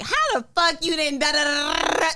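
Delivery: high mock scolding jab; teasing scold. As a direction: playfully scold a late friend. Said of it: "talking my little shit".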